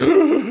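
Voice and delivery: high, loud mock gasp; startled gasp. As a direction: gasp as if at a jump scare. Acts out own jump-scare reaction.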